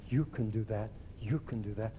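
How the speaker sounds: low, hushed, repeated encouragement; soft, deep, quietly convinced; inner voices. Retold or imitated. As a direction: murmur it like a thought dawning. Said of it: "A thousand voices whirled over my head".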